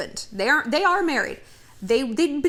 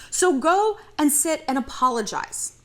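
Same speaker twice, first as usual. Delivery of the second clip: ordinary talk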